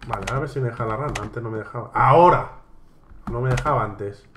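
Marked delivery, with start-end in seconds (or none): 1.9-2.5 sudden loud exclamation; exasperated outburst. Real, not acted. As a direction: burst out loudly at the game. Exasperated when the game finally allows it.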